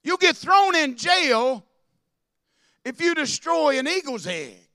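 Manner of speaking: preaching at full force. Acted. Pastor rails to his church, loud preaching. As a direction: hammer it home to the congregation.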